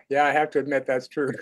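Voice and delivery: ordinary talk, nothing marked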